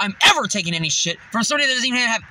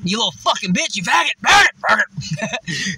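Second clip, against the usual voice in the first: insults building to a shout; acted cussing out; 3 dB louder than usual. Cussing back at the driver, angry shouting. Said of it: "cussing at me, and I just crumbled him".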